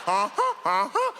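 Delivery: mock honking "uh-huh"; Canadian goose honk. Comedian imitating how Australians sound, goose-like honking. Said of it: "They sound like Canadian geese".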